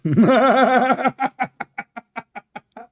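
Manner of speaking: loud, high cackling laugh; gloating laugh. Real, not acted. Debater laughs loudly at his opponent's expense, high and gloating. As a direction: burst out laughing in triumph.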